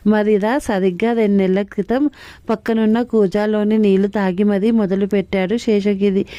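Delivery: usual speaking voice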